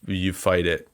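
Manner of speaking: usual speaking voice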